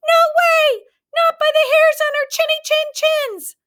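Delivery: very high, loud squeaky pigs; shrill defiant little pigs; three pigs refuse the wolf together, high and loud; pigs crying out. A narrator's reading. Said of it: "cried the three little pigs".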